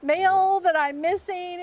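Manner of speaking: high, rushed, flustered retelling; flustered panic. Retold or imitated. Telling the podcast about the Postmaster General call, high and flustered. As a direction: relive the mortifying moment, pitch shooting up. Caught peeing on the phone.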